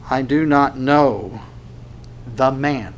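slow, level, deliberate denial; measured denial. Acted. Peter denies knowing Jesus, measured denial. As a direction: deny it evenly, word by word. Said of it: "will become more intense about his denial".

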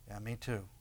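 low, hushed aside; quiet admission. Acted. Acted reply to someone confessing anger, quiet admission. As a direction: own up softly, almost to yourself.

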